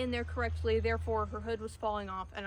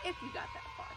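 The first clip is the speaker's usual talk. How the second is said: squeal; 6 dB quieter, much faster than usual. Pretend. Sudden high shout.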